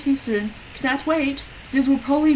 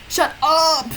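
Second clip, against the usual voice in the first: roaring; 5 semitones higher, 4 dB louder than usual. A narrator's reading. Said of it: "Lumpkin roared".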